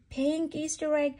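usual speaking voice

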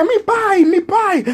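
high sing-song pleading; boy sucking up to the deacon; fawning kid. Retold or imitated. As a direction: sweet-talk the deacon. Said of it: "Y yo decía".

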